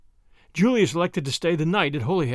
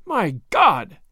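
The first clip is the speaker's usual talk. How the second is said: a louder, shocked outburst; the breath knocked out of him, mildly played; understated gasp of horror; 4 dB louder than usual. A narrator's reading. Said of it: "gasped Tommy".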